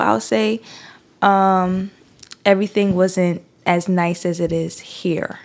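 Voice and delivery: usual speaking voice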